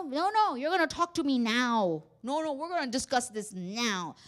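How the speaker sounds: loud, acted confrontational refusal; insistent demand. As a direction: play a partner demanding it be settled at once. Acted partner refusing a timeout.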